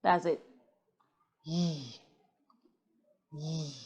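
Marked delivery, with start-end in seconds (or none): 1.4-3.7 "Yee" standing in for a held, buzzing zh; low, soft sustained zh for "Yee"; sound demo. Shown as a demonstration. Teacher showing how the sound is written, sustained consonant. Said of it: "How do you write that sound?".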